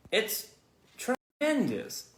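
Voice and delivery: high, slow marvelling; awed boy. Acted. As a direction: stretch it out in wonder.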